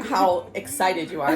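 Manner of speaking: ordinary delivery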